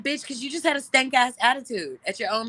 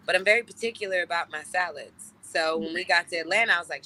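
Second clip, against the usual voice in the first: ordinary talk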